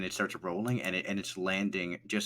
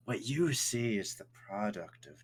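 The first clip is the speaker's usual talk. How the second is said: eerie whisper; slower than usual. Acted. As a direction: breathe it out as a creepy whisper. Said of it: "He goes".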